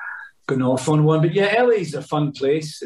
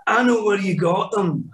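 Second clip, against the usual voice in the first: [name]; 6 semitones higher than usual. Retold or imitated. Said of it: "Billy on the boots".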